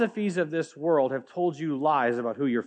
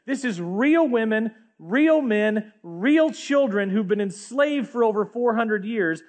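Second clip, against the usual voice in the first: higher, louder impassioned preaching; preaching at full force; 7 semitones higher, 4 dB louder than usual. Acted. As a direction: drive it home to the congregation. Preaching climax.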